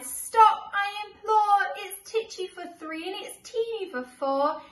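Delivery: loud, high desperate plea; old lady at wits' end, shouting high; old lady crying out. Acted. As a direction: beg them all to stop. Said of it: "The little old lady cried".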